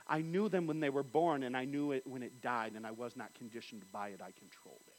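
quiet voice of God. Acted. Preacher voicing God, softly fading to near silence. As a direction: let God's words sink to a hush.

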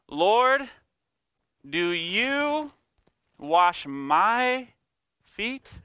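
"you" और "my" पर ज़ोर, ऊँचा उठता सवाल; stress on you and my. Retold or imitated. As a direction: lean on you and my, rising question. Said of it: "With emphasis on the you and my".

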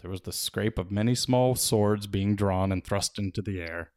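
usual speaking voice

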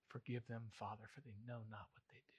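unvoiced hush, barely breathed; Jesus praying from the cross in a whisper; whispered prayer. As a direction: whisper it like a dying man's plea.

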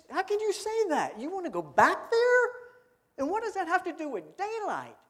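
high, loud incredulous pleading; alarmed protest. Retold or imitated. Disciples protesting to Jesus, alarmed and incredulous. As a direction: object as if he's lost his mind. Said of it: "I'm kind of thinking it was like this".

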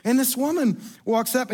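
usual speaking voice

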